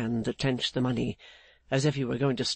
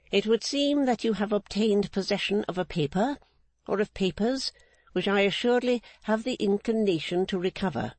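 Mrs Clennam; 7 semitones higher than usual. Acted. Mrs Clennam refusing Rigaud's demand, higher stern unbending woman. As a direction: hold the line like an unbending matriarch.

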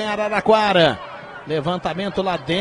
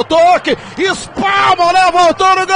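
explosive goal commentary; 4 semitones higher, 7 dB louder than usual. Real, not acted. Goal call.

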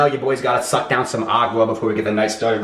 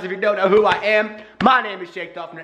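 higher, hyped YouTuber delivery; hype intro; 7 semitones higher than usual. Shown as a demonstration. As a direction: sell it with big energy. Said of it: "I'll show you how it's done".

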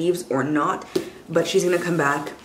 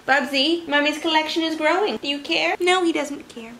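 pet talk; 9 semitones higher than usual. Real, not acted. Talking to her dog.

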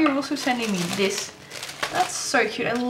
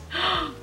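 sharp gasp; 7 semitones higher, 5 dB quieter than usual. Real, not acted. Sees the striped socks.

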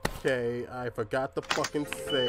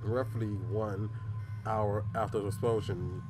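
reading a document; 5 semitones lower, 7 dB quieter than usual. Acted. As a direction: read the file aloud, hushed. Reads in-game document aloud.

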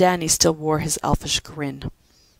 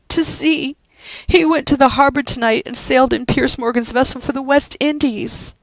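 tearful; 9 semitones higher, 6 dB louder than usual. Acted. Winnie explains through tears.

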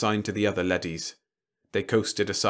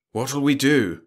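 higher, louder, anxious question; nervous; 6 semitones higher, 6 dB louder, slower than usual. A narrator's reading. Moss turns to Franks for orders, nervously. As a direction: ask with nervous urgency. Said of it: "Moss said nervously to Franks".

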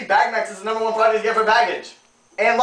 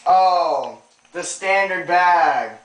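mock disgust; 7 semitones lower, slower than usual. Mock disgust at plain bag.

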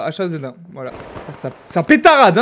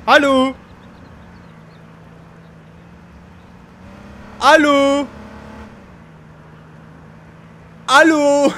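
calling out; 5 semitones higher, much slower than usual. Real, not acted. Gamer testing a dead microphone, calling out. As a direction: call repeatedly to get a response.

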